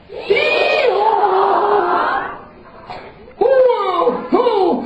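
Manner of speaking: very high shouted bray; donkey bray. Acted. The donkey brays again, loud and high.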